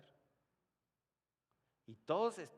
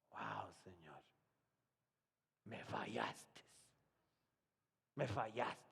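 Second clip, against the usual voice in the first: broken lament; 6 semitones lower, 4 dB quieter, slower than usual. Retold or imitated. Pastor weeping in his car.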